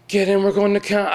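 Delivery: high, loud replay of a called invitation; retold call-out. Friend repeating the driver's invitation on the street, calling out.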